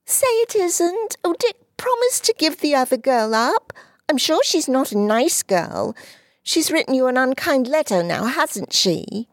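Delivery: put-on high tearful girlish plea; pleading little girl. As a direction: beg him to give her up. Dulcie pleading.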